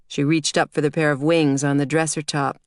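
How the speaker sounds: ordinary delivery, nothing marked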